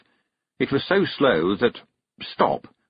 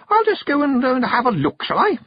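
high, loud sugary sing-out; sticky-sweet voice; 5 dB louder than usual. A narrator's reading. Witch leaving the youngsters alone, sticky-sweet. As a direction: make a syrupy excuse to slip away. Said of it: "in a sticky, sweet voice".

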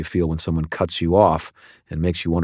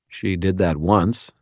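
usual speaking voice

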